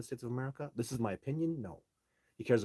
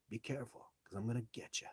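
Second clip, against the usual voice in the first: mock threat whisper; 8 dB quieter than usual. Playful warning to liars.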